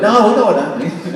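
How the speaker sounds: high, laughing mock student voice; amused students' reply. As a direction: imitate the locals, amused and knowing. Students correct the puzzled teacher.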